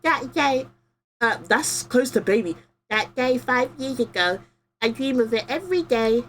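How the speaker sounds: hint of a small kid remembering; lightly voiced child. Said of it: "the most kid-like voice I can".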